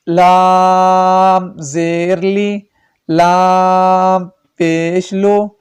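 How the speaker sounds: slow, drawn-out chant of each letter and vowel; qaida recitation. Shown as a demonstration. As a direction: recite the drill, stretching every syllable.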